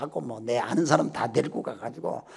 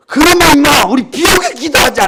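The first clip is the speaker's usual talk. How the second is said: loud, higher bold call to pray for rain; rally your son with a sudden idea; a father's loud, playful challenge; eager exclamation; 10 semitones higher, 15 dB louder than usual. Retold or imitated.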